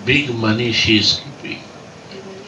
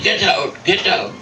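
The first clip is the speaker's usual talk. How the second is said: higher mock shout of get out; retold angry order; 7 semitones higher than usual. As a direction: throw them out, half in earnest. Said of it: "she was angry".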